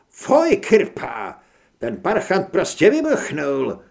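loud, thrilled outburst in character; excited exclamation. A narrator's reading. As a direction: exclaim loudly in amazement. Aino exclaims over the explosion. (vzrušené zvolání)

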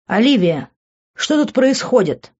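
angry. A narrator's reading. Hugh confronts Olivia from below, angry. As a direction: demand an explanation with some anger. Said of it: "зло спросил Хью".

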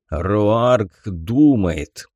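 slow, otherworldly creature voice; slow alien voice. Acted. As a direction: drawl each word like an alien.

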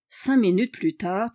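usual speaking voice

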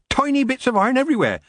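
high, quick witch's patter; quick explanation. Acted. Witch describes the iron scattering, brisk and high. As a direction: rattle it off high and fast. First take before the stressed repeat.

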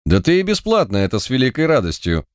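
ordinary delivery, nothing marked

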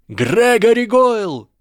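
high, drawn-out challenge yell. A narrator's reading. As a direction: yell the name across the field. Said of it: "завопил Гарри". (протяжный вопль)

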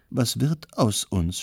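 plain reading voice

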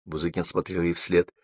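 plain reading voice

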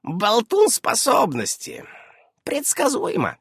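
ordinary delivery

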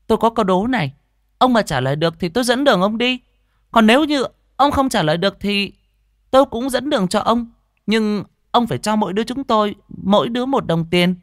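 put-on high-pitched little boy voice; cheeky village boy. Boy setting a riddle for a stranger, high childish voice. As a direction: pitch it right up like a child bargaining.

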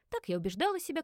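plain reading voice

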